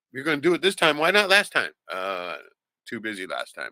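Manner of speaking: ordinary delivery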